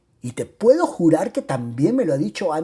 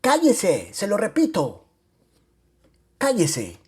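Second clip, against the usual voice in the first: higher, insistent command to shut up; shouted order; 5 semitones higher than usual. A narrator's reading. Narrator twists mother-in-law's arm, shouting at her. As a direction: cry it out, then repeat firmly. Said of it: "se lo retorcí y le grité".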